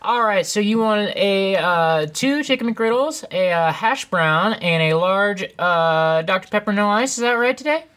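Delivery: bright high drive-thru cashier; chirpy fast-food worker voice; customer service voice. Shown as a demonstration. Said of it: "customer service voice".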